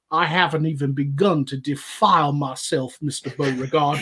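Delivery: put-on Savannah Georgia accent. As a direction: play a Southern gentleman with a Savannah accent. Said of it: "that's the Savannah, Georgia accent".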